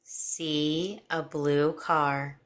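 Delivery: slow, calm guided imagery; hypnotic voice. Acted. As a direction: paint each image slowly.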